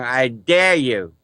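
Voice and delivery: normal talking voice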